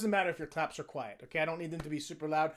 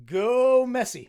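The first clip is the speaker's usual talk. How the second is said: cheer; 6 semitones higher, 11 dB louder, much slower than usual. Shown as a demonstration. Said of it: "my cheering for Argentina".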